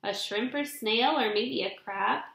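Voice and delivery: ordinary delivery, nothing marked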